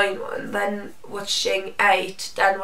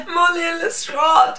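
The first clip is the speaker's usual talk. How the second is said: fan plea; 7 semitones higher, 3 dB louder than usual. Real, not acted. Fans pleading for a ship.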